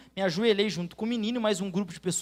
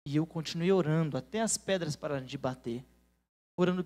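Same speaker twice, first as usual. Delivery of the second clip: ordinary talk, nothing marked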